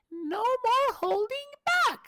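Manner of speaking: put-on very high, slow, girly champion voice; high-pitched woman's voice, dragged out slowly; woman's voice impression. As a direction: pitch way up like a female game hero. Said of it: "let me take a woman voice".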